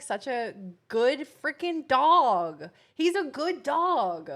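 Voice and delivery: loud, drawn-out gushing praise; emphatic praise. Real, not acted. Praising him as a good dog, loud drawn-out gushing. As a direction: stretch "dog" out loud and proud.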